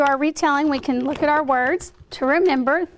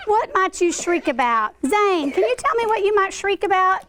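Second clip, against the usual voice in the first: ordinary talk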